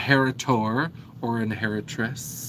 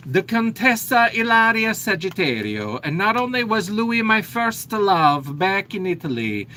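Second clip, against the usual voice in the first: higher, hammed-up Italian accent; 6 semitones higher than usual. Contessa tells the audience of her lost love, Italian accent. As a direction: play the contessa in a deliberately clumsy Italian accent. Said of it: "why they asked me to do accents".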